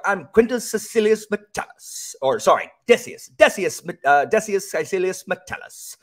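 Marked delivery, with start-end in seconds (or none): 0.0-2.2 high, loud narrator impression; John Lee. Imitating an audiobook narrator reading a Roman detective novel. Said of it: "he talks just like that in this tone of voice". 2.9-6.0 high, loud narrator impression; John Lee. Imitating the audiobook narrator introducing the Roman hero. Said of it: "he talks just like that in this tone of voice".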